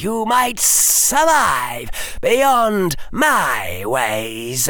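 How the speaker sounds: high, slow, menacing villain voice; cold rasping evil emperor. Acted. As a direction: taunt him like a cruel dark lord. Dark Lord taunts Harry.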